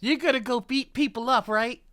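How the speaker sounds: loud, fast, gleeful question; excited teasing question; excited tease. Real, not acted. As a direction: egg him on playfully.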